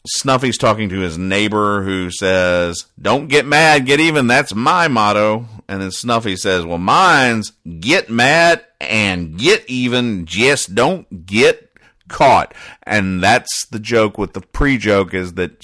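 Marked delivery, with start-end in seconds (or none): 3.0-5.4 higher, louder folksy neighbor voice; hillbilly neighbor. Acted. Reading the comic, neighbor brags his motto in a raised folksy voice. 6.6-12.5 slow, raised country voice; Snuffy's drawl. Acted. Snuffy tops his neighbor's motto in a slow sly country voice.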